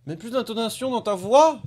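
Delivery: high, loud mock exhortation; exasperated coaching. Real, not acted. Vlogger heckling a friend's old clip for its delivery, exasperated coaching. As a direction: call it out with exaggerated intonation.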